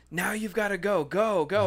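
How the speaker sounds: high, eager go go go; urgent pushing. Retold or imitated. Telling the woman in line to go, high and urgent. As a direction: hurry her in. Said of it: "was like".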